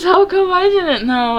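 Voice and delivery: put-on a little girl gasping at news of a fire; surprised short girl. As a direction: pop up high and startled.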